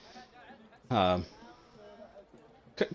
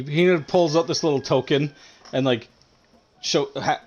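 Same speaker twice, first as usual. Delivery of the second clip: ordinary delivery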